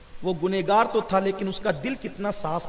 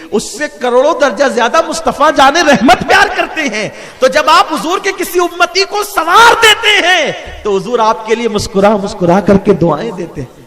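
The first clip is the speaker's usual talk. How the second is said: loud, soaring sermon delivery with laughing joy; fervent preacher building to a joyful peak; preaching at full force; 3 semitones higher, 10 dB louder than usual. Acted. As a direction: lift the crowd, louder and higher with each line. Preaching climax about the Prophet's smile.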